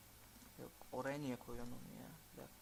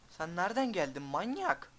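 panicked yell; 4 semitones higher, 9 dB louder than usual. Real, not acted. Yelling at mob that appeared.

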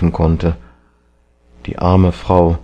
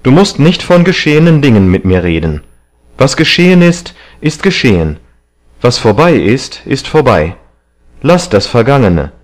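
raised, insistent voice; agitated protest; 5 semitones higher, 4 dB louder than usual. A narrator's reading. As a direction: keep arguing heatedly to shut the subject down. Dorian goes on protesting after outburst. (aufgebracht, mit erhobener Stimme)

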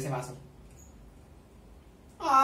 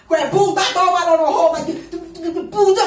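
loud, high cursing shouts; real loud cursing at the screen; excited yelling; 6 semitones higher, 9 dB louder than usual. Real, not acted. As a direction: yell at the screen in delight. Cursing at fight scene.

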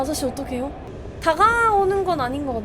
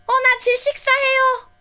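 panicked outcry; 8 semitones higher, 3 dB louder, slower than usual. Real, not acted. Player choking in game gas.

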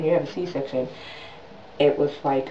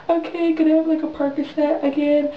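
high-pitched little plea to a nurse; mock patient request; 9 semitones higher than usual. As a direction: ask the nurse in a put-on high voice. Acts her call to the nurse.

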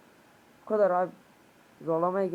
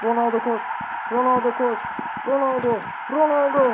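higher and louder calls of the name, rising each time; excited urging; 5 semitones higher, 6 dB louder than usual. Real, not acted. Gamer urging Ronaldo to run, mounting excited shouts. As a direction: urge him on as the chance builds. Urging player on during attack.

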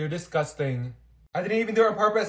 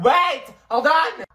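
urgent call; 8 semitones higher, 7 dB louder, much slower than usual. Retold or imitated. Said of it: "And I was like".

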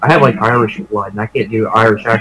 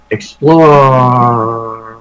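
long, stretched "Explore"; drawn-out urging. Real, not acted. Nudging a player to explore, drawn-out urging. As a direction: draw the last vowel right out.

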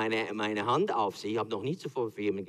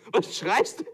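slow, higher, laughing God's voice; amused God; 7 semitones higher, 4 dB louder than usual. Acted. God answering Moses, laughing mock reproach. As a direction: tease him from on high.

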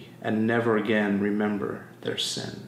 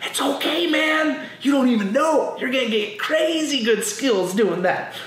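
high, excited reassurance to the screen; reassuring Daniel; 12 semitones higher than usual. Retold or imitated. Grown viewer excitedly reassuring Daniel through the screen. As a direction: cheer him on like you know the ending. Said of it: "You're like".

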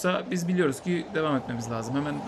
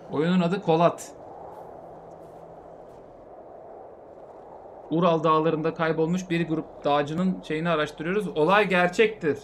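slow dramatic intro; announcer voice; slower than usual. Acted. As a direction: present it slowly and gravely. Announces the game to viewers.

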